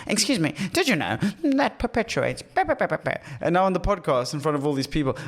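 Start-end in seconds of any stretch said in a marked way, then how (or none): 0.1-2.4 high prissy mock voice; mocking imitation. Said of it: "And she's like". 2.4-3.2 high mock gibberish; mocking blah blah. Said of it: "And she's like".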